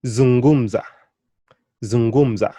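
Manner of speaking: Zungumza with a loud GUM, falling on za; penultimate stress. Shown as a demonstration. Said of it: "stresses on those syllables".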